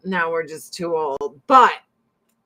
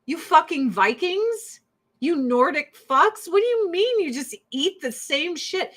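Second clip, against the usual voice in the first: higher, incredulous rant at the husband; mock outrage; 7 semitones higher than usual. Scolding her Nordic husband over repeat dinners, mock outrage. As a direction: ham up the disbelief. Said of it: "I'm like".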